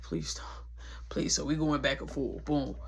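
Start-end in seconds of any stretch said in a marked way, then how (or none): 0.0-1.3 very soft, under the breath; whispered pleading. Retold or imitated. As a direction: act out helplessly begging in a whisper. Retold pleading during intimate moment.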